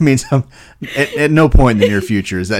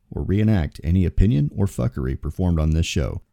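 mock warning disclaimer; 2 semitones lower, 5 dB quieter than usual. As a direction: read the show warning with mock officialdom. Reads the show's opening disclaimer.

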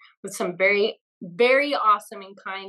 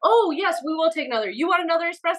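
higher, eager mock bravado; hyped on espresso; 6 semitones higher, faster than usual. Offered more espresso by Italian actors, hyped higher yes. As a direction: accept the next shot like a daredevil. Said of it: "at this point I'm like".

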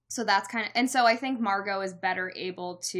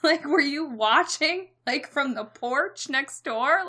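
incredulous; 6 semitones higher, 7 dB louder, slower than usual. Real, not acted. Incredulous aside about Josh.

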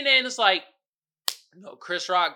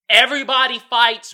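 emphatic; 5 semitones higher, 12 dB louder than usual. Real, not acted. Stating point emphatically.

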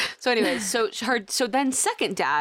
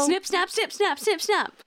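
high sing-song snip snap; chirpy snipping chant; a lightly voiced Michael Scott singsong; Michael Scott quote; 8 semitones higher, slower than usual. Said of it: "Quote Michael Scott".

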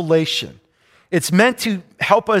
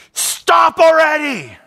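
retold shout; 9 semitones higher, 4 dB louder, slower than usual. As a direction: Stop already! yelled much higher and louder. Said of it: "I want to shout".

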